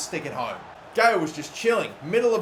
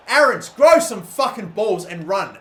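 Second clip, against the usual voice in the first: loud, slow, furious at the player; angry yell; 5 semitones higher, 11 dB louder, slower than usual. Real, not acted. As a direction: bark it at a useless teammate. Yelling at his player.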